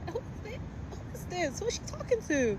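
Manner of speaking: hammed-up who-is-this incredulity; incredulous. Retelling her shocked reaction.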